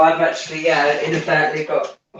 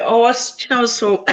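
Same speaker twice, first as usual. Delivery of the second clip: choking; 6 semitones higher, faster than usual. Real, not acted. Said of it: "Just choking".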